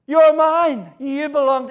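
high, loud triumphant cry of You're mine; shout of triumph. Acted. God holding his people up, crying out in triumph. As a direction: lift the child up and shout it in triumph. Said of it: "it's almost a shout of triumph".

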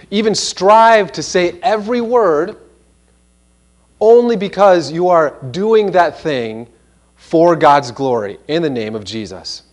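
normal talking voice

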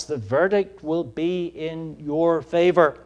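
usual speaking voice

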